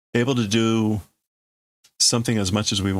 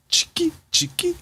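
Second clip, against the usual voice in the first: whispered mouth clicks; whispered click sound. Acted. Player voicing the gun loading, whispered clicks.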